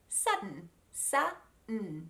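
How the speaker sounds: "Sudden" whole, then split as "sa, mm"; "sa", a stop, then hummed N; silent D broken down. Shown as a demonstration. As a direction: sound out "Sudden" with no D release. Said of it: "and then make a mm sound".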